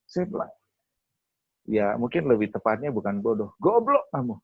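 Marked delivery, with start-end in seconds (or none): 3.6-4.4 high-pitched mock insult; sudden high jab of rebuke; mock scolding. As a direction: re-enact a blunt telling-off. Voices God's blunt rebuke.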